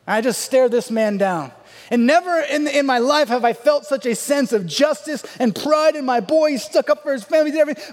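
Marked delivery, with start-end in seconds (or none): 1.9-7.9 high, excited triumphant gush; elated, raised-voice recounting; swelling pride. Retold or imitated. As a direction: let the pride burst out. Teller relives pride and triumph.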